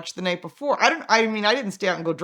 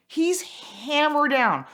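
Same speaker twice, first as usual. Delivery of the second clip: ordinary delivery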